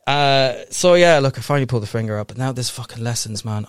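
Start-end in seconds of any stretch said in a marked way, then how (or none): none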